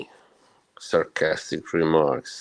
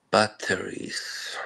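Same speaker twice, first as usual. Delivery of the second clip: slow whisper; 2 semitones higher, 5 dB quieter, slower than usual. Shown as a demonstration. Streamer repeating his word to chat, whispered. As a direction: breathe the word Batteries slowly with no voice.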